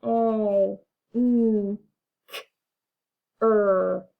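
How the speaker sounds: A N C H-O R, letter by letter, slowly; slow letter-by-letter anchor; spelling it out. Shown as a demonstration. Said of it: "Let's tap anchor".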